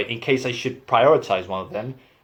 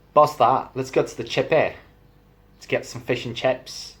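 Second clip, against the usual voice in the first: Liverpool accent with softened final T; casual Liverpudlian demo; Scouse accent. Shown as a demonstration. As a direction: do a Scouser, dropping the T in "that". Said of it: "final T, they don't use it".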